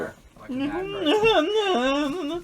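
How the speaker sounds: high, slow, laughing loudly. Real, not acted. Game master mocking the character's hand-waving, laughing loudly. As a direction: laugh the whole line out.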